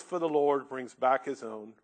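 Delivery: ordinary delivery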